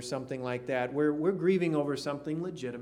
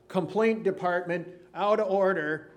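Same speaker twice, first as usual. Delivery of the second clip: surly shop attitude; 4 semitones higher, 6 dB louder than usual. Retold or imitated. Pastor mocking a rude business sign, gruff and dismissive. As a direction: bark it like a cranky backwoods clerk. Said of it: "those type of attitudes".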